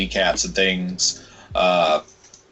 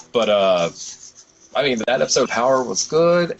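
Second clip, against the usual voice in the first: usual speaking voice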